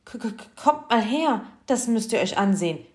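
stuttering, loud, high-pitched shout; alarmed, spluttering summons; agitated call. A narrator's reading. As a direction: stammer the first word, then call urgently. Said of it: "rief er aufgeregt".

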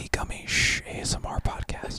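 ASMR whisper. Acted. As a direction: breathe it out softly. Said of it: "so we're just gonna whisper".

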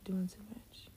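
under the breath; whispered. Real, not acted. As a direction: mutter it under your breath. Mocking whisper aside.

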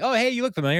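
high, bright eager greeting; eager goofy greeting. Retold or imitated. Andy eagerly greeting the stranger, high and goofy. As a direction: play the dim, cheerful guy.

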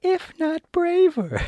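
very high cartoon lion voice; squeaky boastful lion, drawing out the last words; self-satisfied lion. Lion declares himself content with his courage, mock-modest high voice. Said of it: "said the lion modestly".

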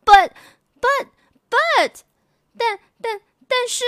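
put-on very high, halting little girl buts; a child trying to argue back; stammering protest.

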